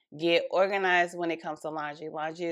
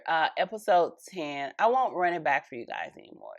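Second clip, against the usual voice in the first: ordinary talk, nothing marked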